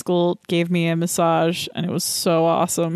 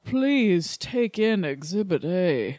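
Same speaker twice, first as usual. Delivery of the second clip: honeyed southern lawyer drawl. Acted. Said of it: "Turned us into, like, Southern lawyers".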